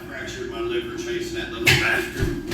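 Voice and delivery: out of breath from the chase; winded mock gasp; breathless.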